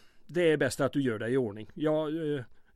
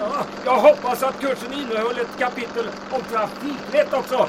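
higher, louder yell over the engine; shouting over wind; 7 semitones higher, 4 dB louder than usual. Acted. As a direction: call out above the rushing wind.

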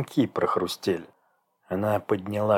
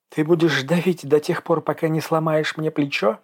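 higher, evenly pitched indifferent question; 6 semitones higher than usual. A narrator's reading. Dasha asks about her gripped shoulder, indifferent. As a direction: ask as if unbothered by pain. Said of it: "спросила девушка равнодушно".

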